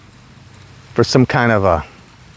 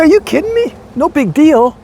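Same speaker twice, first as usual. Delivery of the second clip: incredulous; 13 semitones higher than usual. Retold or imitated. Incredulous at Bert's modesty.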